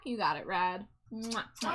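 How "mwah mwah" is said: string of kiss sounds; big wet smooch. Pretend. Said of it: "Is that a Smooch Your Wife ten pack?".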